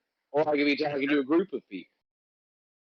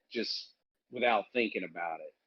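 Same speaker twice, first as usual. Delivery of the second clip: normal talking voice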